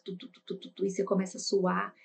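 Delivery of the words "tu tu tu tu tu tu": very soft rapid "tu, tu" heartbeat; racing heartbeat imitation. Shown as a demonstration. Teacher mimics a panicking heart: very soft "tu tu". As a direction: whisper out a racing pulse. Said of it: "e seu coração tu".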